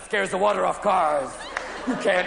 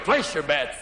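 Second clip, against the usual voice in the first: lightly voiced croupier, lower pitch; low call to the table; 7 semitones lower, slower than usual. Spinning room becomes roulette, low croupier call.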